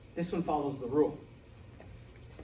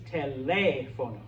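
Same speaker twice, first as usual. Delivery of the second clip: teléfono with a louder, held LÉ; stress on second syllable; 4 dB louder than usual. Shown as a demonstration. Spanish teacher shows the class the accented syllable, teléfono stressed on LÉ. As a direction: punch the LÉ in teléfono. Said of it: "we put extra stress".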